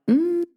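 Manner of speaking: mock their hesitation; hesitant hum. Imitating hesitant people.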